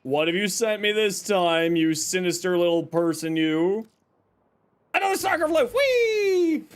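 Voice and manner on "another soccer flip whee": high gleeful squeal; excited squeal. Real, not acted. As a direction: squeal with delight. Thrilled at a soccer flip.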